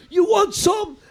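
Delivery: high, loud taunting yell; mock shout. Callan shouting a taunt at the creep. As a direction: shout it out to pick a fight. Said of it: "Chirping him like".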